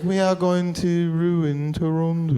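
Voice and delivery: slow, deliberate mock Chinese accent; Olivia Chow. Comic showing the mayor's accent to the crowd, slow Chinese accent. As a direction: draw each word out in a Chinese-accented impression. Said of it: "a light accent. Have you heard her accent?".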